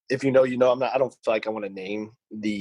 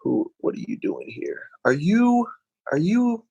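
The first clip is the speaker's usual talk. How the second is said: incredulous questioning; 8 semitones higher than usual. Retold or imitated. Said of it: "I'm like".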